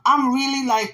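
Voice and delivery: usual speaking voice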